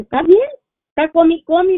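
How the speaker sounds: imitating a cheerful grandmother. Daughter retelling how grandmother boasted she was eating fine, in a high loud mock voice. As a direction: pitch it up and out like a carefree grandmother.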